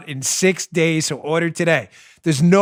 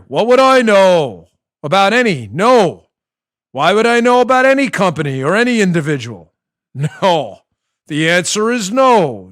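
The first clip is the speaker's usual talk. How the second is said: louder, stiff politician denial in a put-on voice; John Kerry impression; 2 semitones higher, 6 dB louder than usual. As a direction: deny everything like a stiff senator. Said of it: "My John Kerry's not nearly as good".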